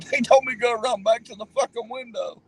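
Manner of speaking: high-pitched, mildly acted outrage; retelling it worked up, a touch angry; retold indignation. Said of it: "I was pissed".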